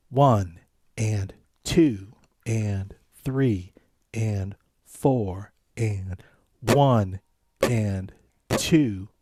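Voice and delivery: slow, steady count-along; teacher counting beats slowly. Shown as a demonstration. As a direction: count each beat out evenly for the class.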